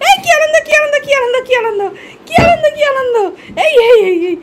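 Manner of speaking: high, squealing chant of delight; gleeful pet talk. Real, not acted. Cheering the pet's joy, gleeful high baby talk. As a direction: squeal over the excited pet.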